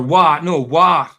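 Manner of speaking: "war" loud, with w instead of f; Dundee "war". Shown as a demonstration. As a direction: punch out the corrected "war". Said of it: "that's what they'd say".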